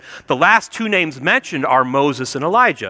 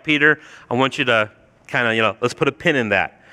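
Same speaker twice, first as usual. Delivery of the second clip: low, quick, soft put-off; Jesus gently checking Peter; 6 semitones lower, 3 dB quieter, faster than usual. Acted. Jesus asking Peter to hold off, low casual restraint. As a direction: rein him in quietly and casually. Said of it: "Jesus is like".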